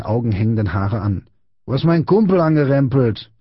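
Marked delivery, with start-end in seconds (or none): 1.7-3.3 raised, high confrontational voice; bullying accusation. A narrator's reading. Big boy facing Friedrich, aggressive accusation.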